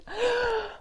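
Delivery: a sudden high sharp gasp of shock. Real, not acted. Reviewer gasping at the character's death, a sharp shocked gasp. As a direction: gasp as if hearing the news fresh.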